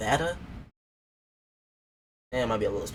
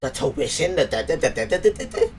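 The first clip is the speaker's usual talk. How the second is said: loud, higher mocking parody; mocking her mean tone; 4 semitones higher, 5 dB louder than usual. Mocking a guest's serious way of talking, loud parody. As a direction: mimic her stern mean voice with nonsense syllables. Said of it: "she talks like she's mean as fuck".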